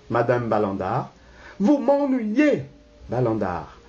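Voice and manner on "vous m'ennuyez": high-pitched, irritated snub; annoyed. Acted. Wife answers husband who stops her, annoyed. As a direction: brush off the meddling husband.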